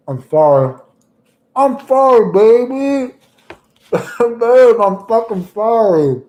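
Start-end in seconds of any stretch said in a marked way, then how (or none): 1.6-6.2 put-on high, loud whiny baby-talk apology; mock pleading apology. Streamer to female viewers, hammed-up high whiny sorry. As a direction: beg forgiveness in a squeaky mock voice.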